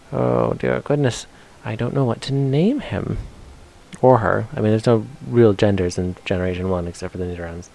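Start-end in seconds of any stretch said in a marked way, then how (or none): none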